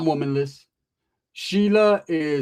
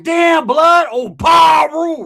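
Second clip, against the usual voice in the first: high, loud drawn-out gang yell; gang banger shout; 12 semitones higher, 11 dB louder, much slower than usual. Retold or imitated. The stranger walking in, loud high gang holler. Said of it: "he's like".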